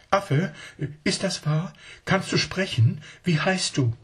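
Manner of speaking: put-on high, eager little child asking its uncle; a small child not believing a word; child's voice. Child questions the monkey.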